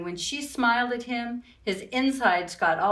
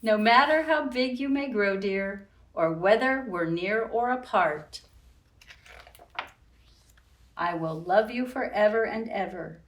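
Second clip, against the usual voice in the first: put-on reading to children. A narrator's reading. Reading picture book aloud.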